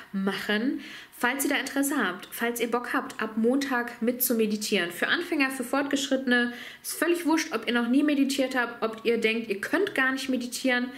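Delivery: ordinary delivery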